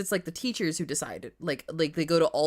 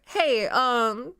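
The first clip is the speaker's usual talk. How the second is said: higher, timid asking voice; 8 semitones higher, 3 dB louder, slower than usual. Retold or imitated. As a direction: tiptoe into the question. Asking teacher in retelling.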